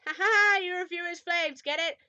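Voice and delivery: loud, high hammed-up laugh and jibe; mock guffaw. Reader jokes about the flames, loud mock-triumphant crowing. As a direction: crow the pun out loudly.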